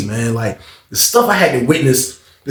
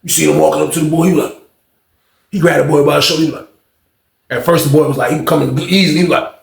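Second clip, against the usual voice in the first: ordinary talk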